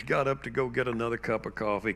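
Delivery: usual speaking voice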